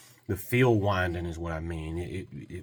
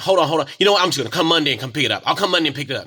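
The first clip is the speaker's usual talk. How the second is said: higher, louder, fast rush to hang up; hurried; 6 semitones higher, 9 dB louder, much faster than usual. Retold or imitated. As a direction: blurt it out and go. Customer abruptly ending call.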